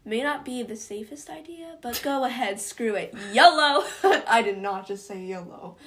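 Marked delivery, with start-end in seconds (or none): 3.3-3.8 loud, higher whoop with a laugh; exuberant shout. Real, not acted. Cheering on a reckless idea, exuberant shout. Mock reckless catchphrase after "screw it. 3.9-5.8 loud, through real laughter; laughing embarrassment. Real, not acted. Host reacting to saying yolo, laughing embarrassment.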